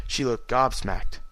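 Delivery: plain reading voice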